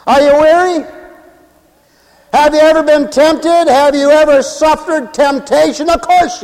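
high, insistent preacher's questions; ringing revival delivery; fervent preaching. Real, not acted. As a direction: press the congregation with each question. Preaching at full force.

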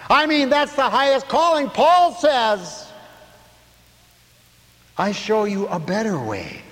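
high, loud, fervent preaching; full-force preaching. Acted. Preacher to his congregation, full-force preaching.

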